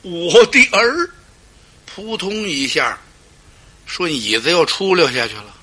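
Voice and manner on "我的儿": drawn-out loud wail, pitched high; anguished cry. Acted. Liu Bei cries out to his nephew, anguished wailing cry. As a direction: cry out for a lost son, dragging the words. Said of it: "大叫了一声".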